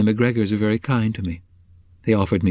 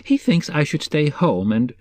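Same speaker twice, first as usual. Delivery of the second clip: troubled Mrs Cobb; 6 semitones higher than usual. A narrator's reading.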